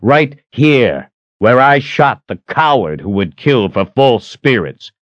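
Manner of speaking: bold, scornful declaration; angry defiance. A narrator's reading. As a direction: spit out the challenge loudly. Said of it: "He pointed angrily at his forehead".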